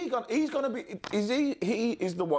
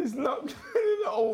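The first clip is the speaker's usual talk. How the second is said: higher-pitched, through laughter; laughing; 11 semitones higher than usual. Real, not acted. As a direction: crack up while speaking. Cracking up at co-host's bit.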